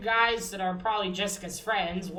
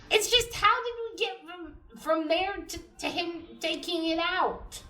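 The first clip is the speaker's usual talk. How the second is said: normal talking voice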